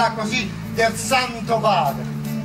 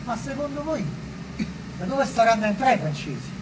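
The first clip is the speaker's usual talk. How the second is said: hushed question; 5 dB quieter than usual. Acted. Roman asking companions about the French entering, hushed. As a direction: ask it quietly, almost to oneself. Question first asked low, then repeated.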